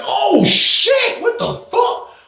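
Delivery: shocked outcry. Retold or imitated. Inmates react to the scream, shocked. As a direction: yelp like you can't believe it.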